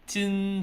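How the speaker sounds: "今" held high and level, jīn; flat first tone. Shown as a demonstration.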